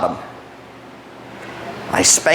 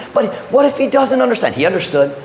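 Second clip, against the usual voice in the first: normal talking voice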